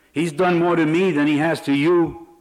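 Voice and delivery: loud, hateful outburst; angry shout. Acted. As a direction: yell it with hatred. Prisoner insists on revenge, hatred.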